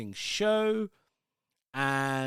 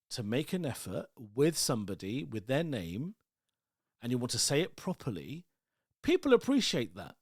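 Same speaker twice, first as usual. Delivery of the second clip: ordinary talk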